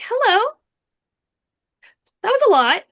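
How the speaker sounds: very cheerful. Acted. Gnome cheerfully greeting the tired orc, high and loud. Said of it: "very cheerfully saying".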